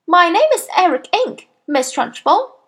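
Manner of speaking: put-on high-pitched little boy's voice; polite schoolboy. A narrator's reading. Boy gives his name to the headmistress, high and polite. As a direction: answer up high, like a pupil to a teacher.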